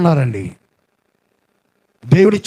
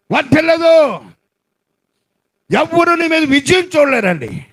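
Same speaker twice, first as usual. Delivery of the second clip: full-force preaching; 9 semitones higher than usual. Acted. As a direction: proclaim the loneliness with force. (గట్టిగా బోధించే స్వరం)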